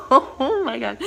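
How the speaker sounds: slow, loud laughing through the words. Real, not acted. Laughing as she recalls the guide's look. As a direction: crack up mid-sentence.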